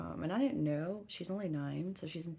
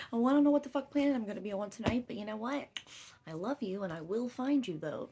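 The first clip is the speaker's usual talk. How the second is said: raised, high and loud, fast in the middle; loud mock reply; 7 semitones higher, 6 dB louder than usual. Mom telling her daughter she's working, loud and exasperated. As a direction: belt it out half laughing, half fed up.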